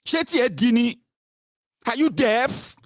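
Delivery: high-pitched exasperated scolding; acted indignant high yell; berating elder. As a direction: berate them as if they won't listen. Acting an elder berating a youth.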